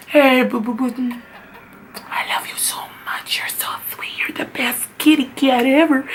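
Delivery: put-on breathy baby talk to a cat; whispered cooing. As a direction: coo lovingly in a near whisper. Cooing affection to her cat.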